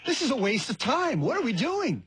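high-pitched, fed-up stepfather; exasperated stepdad. Acted. Stepdad confronting the household, exasperated. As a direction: demand it high, in mock exasperation.

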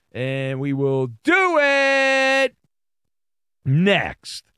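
host hyping the riddle answer, slow and teasing; slow drawn-out tease. Acted. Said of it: "teasing the answer".

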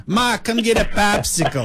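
high, slow Boston lady offering a treat; imitating a Boston farm renter calling him over, Boston accent. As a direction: drawl it out like a Boston neighbour lady. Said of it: "She'd go".

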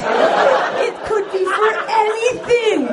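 exasperated wail. Acted. Comic ranting about the ad.

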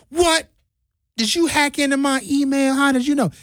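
high, startled disbelief; mock-amazed gasp of a question; shocked. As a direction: play the stunned owner, voice jumping up. Owner stunned he named menu.

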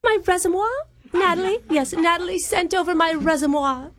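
high, hammed-up posh English accent; put-on British accent. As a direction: prank caller with a fake British lilt. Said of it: "surprised by your accent".

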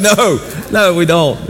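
laughing high protest. Real, not acted. Laughing retelling to son.

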